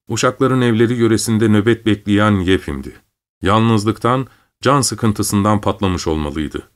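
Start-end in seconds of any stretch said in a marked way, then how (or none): none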